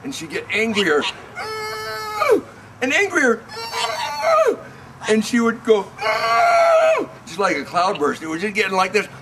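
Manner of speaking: rising from fussy to a high, loud wailing shout; building tantrum. Acted. Parent showing a child how the princess stormed, building to a shout. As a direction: act out a toddler's tantrum getting angrier and angrier. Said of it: "Princess Cloudburst went like this".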